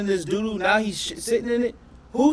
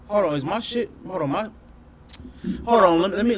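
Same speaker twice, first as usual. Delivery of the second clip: ordinary talk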